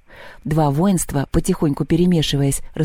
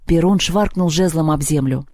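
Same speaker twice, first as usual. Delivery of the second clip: usual speaking voice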